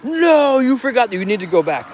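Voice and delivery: hammed-up nagging correction; mock reminder voice. Acting partner's reminder.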